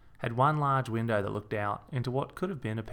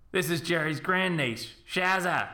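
across-the-pub voice; 7 semitones higher than usual. A narrator's reading. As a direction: project it so the whole pub hears. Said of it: "returning to his across-the-pub voice".

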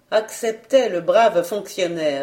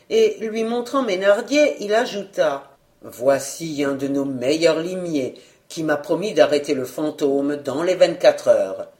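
ordinary delivery

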